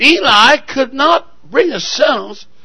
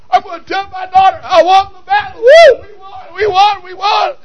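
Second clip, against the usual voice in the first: high, rapid whooping celebration; excited victor; 10 semitones higher, 5 dB louder than usual. Acted. Preacher as father rushing home to tell his daughter, excited. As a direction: crow the victory with high, breathless glee. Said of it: "he's going home excited".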